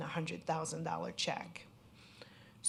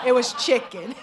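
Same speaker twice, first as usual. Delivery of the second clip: laughing; 5 semitones higher, 12 dB louder than usual. Real, not acted. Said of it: "I have to make light of it".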